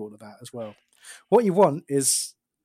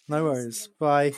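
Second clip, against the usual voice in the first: usual speaking voice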